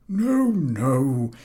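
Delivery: high, quick cutting-in no; interrupting. A narrator's reading. Professor interrupting the reciting boy. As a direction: cut him off. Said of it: "interrupted the professor".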